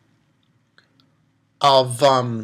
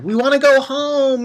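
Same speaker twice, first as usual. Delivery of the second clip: put-on whiny, pitched-up child's plea; crying kids; 9 semitones higher than usual. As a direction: imitate homesick children pleading. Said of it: "they were crying and saying they wanna go home".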